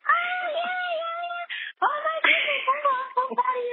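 very high, squealing with joy; overjoyed mom squealing congratulations; mom's excited squeal. Retold or imitated. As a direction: shriek it like a thrilled mother on the phone. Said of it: "She was like".

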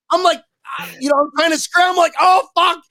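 high, fast panicked rush. Retold or imitated. Skier panicking as the chair arrives, high and fast. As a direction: scramble in a panic. Panicked scramble onto the lift.

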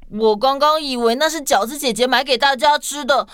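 ordinary delivery

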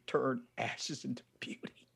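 soft, breathy whisper of a promise; whispered divine promise; hushed whisper. Retold or imitated. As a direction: drop to a whisper. God's voice, hushed.

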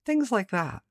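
normal talking voice

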